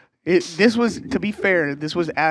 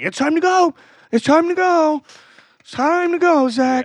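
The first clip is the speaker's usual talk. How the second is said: high, loud mock yell of a mom; imitating an annoying mom yelling her son awake; shouting mom; 13 semitones higher, 8 dB louder, slower than usual. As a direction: bellow the wake-up call way up high. Mom yelling wake-up, clapping.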